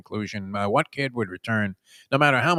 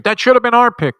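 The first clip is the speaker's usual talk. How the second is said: high, loud imitated scream; 13 semitones higher, 12 dB louder than usual. Dead ballplayer screaming from the grave over a lost pick. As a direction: scream it out in outrage, pitch way up. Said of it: "from the grave was screaming".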